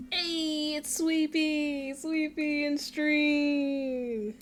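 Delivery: slow, drawn-out, high hype call; excited announcement. Real, not acted. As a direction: stretch it out like a fanfare.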